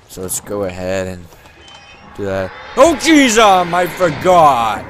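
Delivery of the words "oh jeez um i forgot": startled. Real, not acted. Startled by ghost in game.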